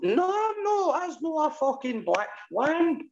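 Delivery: very high, drawn-out squeal of protest; real high-pitched indignation; exasperated protest. Real, not acted.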